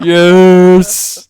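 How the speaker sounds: loud put-on deep voice; booming deep yes. Shown as a demonstration. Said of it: "Just saying yes in a deep voice".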